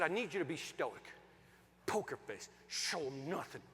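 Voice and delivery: low, soft, restrained instruction; stoic. Retold or imitated. Husband coaching his wife to keep a poker face, stoic restraint. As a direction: coach it quietly, giving nothing away. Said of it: "They're, like, stoic".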